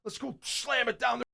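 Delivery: imitating a fired-up defense, pitched high. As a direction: hype up the team as an amped defender. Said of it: "extra fired up to be like".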